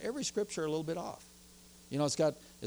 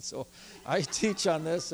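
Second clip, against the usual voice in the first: normal talking voice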